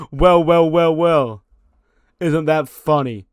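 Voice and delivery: loud, high, slow sinister taunt; villain gloating. Retold or imitated. Shaquan Sugar taunting Javon on the mic, slow and loud. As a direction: laugh then gloat like a heel.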